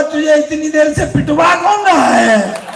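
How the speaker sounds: slow, loud, high payoff line; a shouted comic reveal; punchline shout. Retold or imitated. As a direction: hit the punchline hard and slow. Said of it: "वो बोला".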